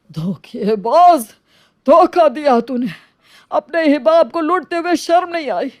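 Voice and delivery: betrayed father shouting at his cheating son; furious outburst. Acted. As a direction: hurl the betrayal at your son.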